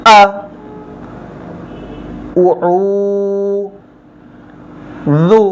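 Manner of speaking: আ, উ, জু each sounded alone, with long gaps; syllable-by-syllable model for repeating; broken-up syllables. Shown as a demonstration. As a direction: sound out আ, short, then a drawn-out উ, then জু. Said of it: "ভাঙা ভাঙাভাবে উচ্চারণ করব".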